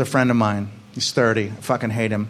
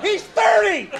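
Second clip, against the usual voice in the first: outraged yell; 3 dB quieter, much slower than usual. Acted. Comic ranting about a grown man's order, outraged yell. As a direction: scream the punchline in disbelief.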